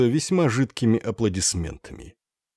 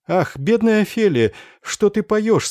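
higher, caring, pitying question; sympathetic queen; 9 semitones higher than usual. A narrator's reading. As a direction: ask gently with pity. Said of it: "спросила её с участием королева".

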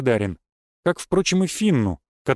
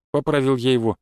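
ordinary delivery

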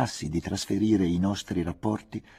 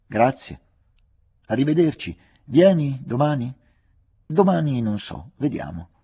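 chiamata a distanza; 7 semitones higher, 6 dB louder than usual. A narrator's reading. Saluti gridati a distanza.

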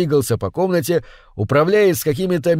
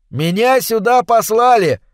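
high shout; 8 semitones higher, 4 dB louder than usual. Acted. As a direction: shout over the noise. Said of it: "ответил Картер и закашлялся". (громкий выкрик)

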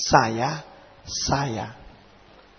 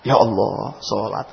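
regretful sigh; 9 semitones lower, 7 dB quieter than usual. Acted. Sighing regret after naming prayer.

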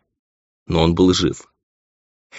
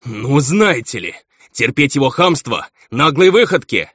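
higher-pitched, affronted outburst; indignant baron; 7 semitones higher than usual. A narrator's reading. Baron protests his stepson's insolence, indignant. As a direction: draw out the affront, voice raised in pitch. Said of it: "Возмутился барон".

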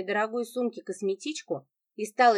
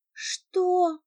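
a high gasp of shock; gasped what; 10 semitones higher than usual. A narrator's reading. Sasha hearing the money is gone, gasping in shock. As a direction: gasp it out, stunned. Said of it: "ахнул Саша".

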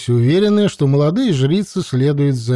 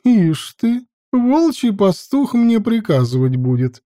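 high scoffing sneer; 8 semitones higher than usual. A narrator's reading. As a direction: scoff at his nerve. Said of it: "хмыкнула добродея".

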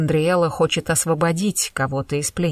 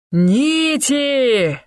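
thunderous shout, much higher and louder; booming thunder; 8 semitones higher, 5 dB louder than usual. Acted. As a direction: roar it out like thunder. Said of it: "Голос Люцифера громом прогремел".

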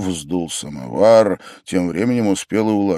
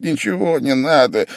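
higher, testy refusal; irritated; 6 semitones higher than usual. A narrator's reading. Sick man turning down any treatment, irritated. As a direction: brush him off with some irritation. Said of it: "раздражительно отмахивался Вельчининов".

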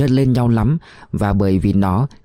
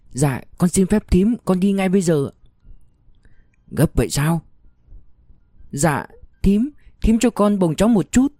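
higher, hushed and humble; pleading young mother; 7 semitones higher than usual. A narrator's reading. (giọng người mẹ trẻ khẩn khoản)

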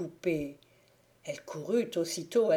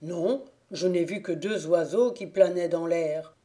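squire's voice; 5 dB louder than usual. Acted. Squire reports again.

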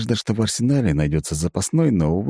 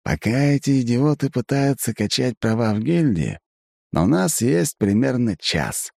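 sly, smiling; 7 semitones higher than usual. A narrator's reading. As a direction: smile slyly as you plot. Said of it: "хитро улыбаясь".

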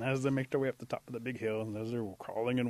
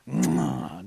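big, loud "mwah"; smacking kiss; 8 dB louder than usual. Acted. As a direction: plant a noisy kiss. Imitates a kiss.

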